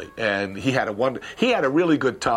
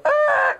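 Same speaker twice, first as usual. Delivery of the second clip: a sharp high squeal of tires; imitated car peeling off; 7 dB louder than usual. Car driving off with John, imitated high screech. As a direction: voice the car speeding away. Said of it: "off, gone".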